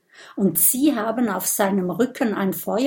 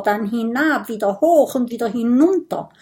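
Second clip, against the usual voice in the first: ordinary delivery, nothing marked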